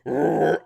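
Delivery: loud rolling growl imitating oxen; ox roar. Game master imitates fleeing oxen, roaring. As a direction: roar like a startled beast. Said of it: "You hear some of the oxen, like, roar".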